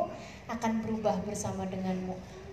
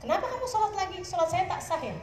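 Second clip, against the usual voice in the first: higher-pitched, mildly puzzled husband asking; questioning husband; 7 semitones higher than usual. Retold or imitated. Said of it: "dia tanya". (suami bertanya heran, nada tinggi)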